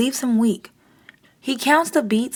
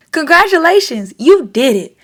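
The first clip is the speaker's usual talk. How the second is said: higher and louder, cheering the class; joyful shout; 6 semitones higher, 6 dB louder than usual. A narrator's reading. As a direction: shout it out proud to the students. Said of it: "Missus Lopez shouts to her students".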